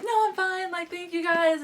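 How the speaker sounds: high-pitched, insistent brush-off; acted brushing-off. Brushes off worried bystanders.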